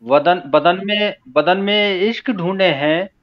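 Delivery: measured, unsung ghazal recital; spoken verse recitation. Acted. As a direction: recite phrase by phrase with deliberate pauses. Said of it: "मैं तहत में ही पढ़ता हूँ".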